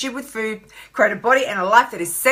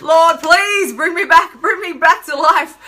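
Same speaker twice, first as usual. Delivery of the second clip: mock prayer; 6 semitones higher, 4 dB louder, slower than usual. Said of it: "I'm like".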